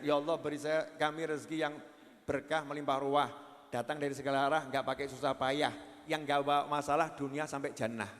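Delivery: low, soft supplication; quiet rhymed prayer. Acted. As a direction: pray it humbly, in rhythm. Recites an Indonesian prayer.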